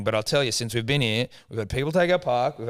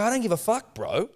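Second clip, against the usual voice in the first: higher, punchy mock retort; retelling how he snapped back at the cleaner; mock defiance; 7 semitones higher than usual. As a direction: fire it back with swagger. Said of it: "I go".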